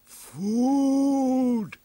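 one long, very high, loud call; creature's thought. Acted. Hungry Stegosaurus sends a message to Tila, a long high cry. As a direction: stretch it out as a hungry beast's cry. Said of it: "creature's mind sent out a simple message".

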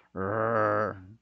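low growled "rrr", like a dog about to bite; English R sound. Shown as a demonstration. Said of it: "como cuando el perro te va a morder".

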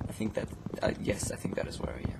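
whispered admission; sheepish whisper. Real, not acted. Lost friend admitting he went wrong way, whispering. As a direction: breathe it out, caught out.

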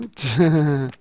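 short chuckle to oneself; laugh. Real, not acted. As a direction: let out a brief, voiced laugh. Laughs while choosing posters.

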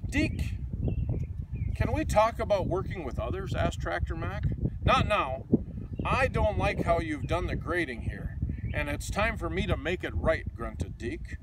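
lively, sing-song picture book reading; reading to children. A narrator's reading. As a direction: read it like a bedtime storybook for kids.

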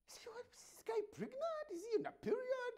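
high mocking voice; 1 mocking falsetto. Real, not acted. Host mocking the sulking follower, high-pitched. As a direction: sneer at him in a high pitch.

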